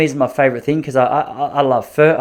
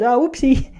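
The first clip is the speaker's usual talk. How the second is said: coy whoopsie; 12 semitones higher, slower than usual. Retold or imitated. Masseuse coy apology.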